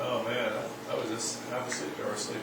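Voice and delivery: soft, dazed half-asleep murmur; drowsy mumble. Retold or imitated. Drowsy driver coming to, softly mumbling. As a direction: mumble like you just nodded off. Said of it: "you're just kinda going".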